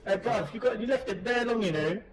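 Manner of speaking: playful roadman telling you off about the fridge; London roadman slang. Pretend. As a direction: hype it up like a London youth. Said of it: "It's kinda nasally".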